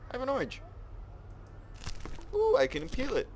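very high, delighted squeal; giddy delight. Pretend. Player discovers an orange to peel, giddy high squeal. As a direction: squeal it like a thrilled little discovery.

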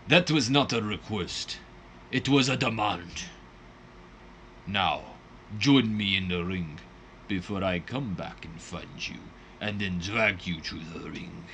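low, menacing put-on Russian accent; bad Russian accent. The Butcher threatening to drag Fuller to the ring, hammy Russian accent. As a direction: growl the threat like a cartoon Russian heel. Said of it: "Horrible Russian accent".

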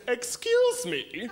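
slow, menacing mock German accent; a hint of a German villain, drawn out; German supervillain. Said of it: "they sound like a freaking supervillain".

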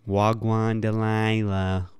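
slow, drawn-out try at Wagwan Delilah; attempting the Toronto character's greeting; Toronto accent attempt. Host tries the Toronto accent he wants to learn. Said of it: "I wanna learn how to do that accent".

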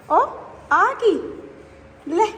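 surprised. Real, not acted. Spotting guavas on the tree, surprised and high. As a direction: gasp it out as you spot something.

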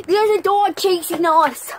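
loud, high-pitched warning; play-acted alarmed yell; mock alarm. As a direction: sound the alarm to friends.

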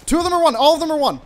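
shouted, high, excited callout; combat callout. Real, not acted. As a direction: yell the enemy health out. Mid-fight callout to teammates.

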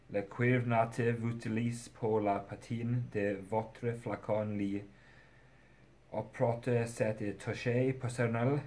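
halting French read in an English accent; clumsy French reading. Real, not acted. Reviewer who can't read French reads the box, clumsy French. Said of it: "I can't read French".

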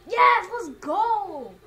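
big drawn-out victory yell; triumphant shout. Real, not acted. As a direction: cheer it out slow and loud. Celebrating a good roll.

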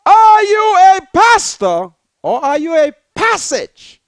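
loud scornful jab, pitch up; loud sneering challenge; mocking shout. Mocking accusation to pastor.

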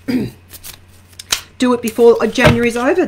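normal talking voice

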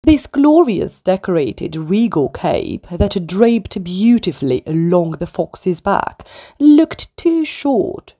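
usual speaking voice

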